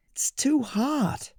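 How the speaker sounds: put-on high, slow whispered girl voice; too hot whisper. Goldilocks whispers to herself over hot oatmeal.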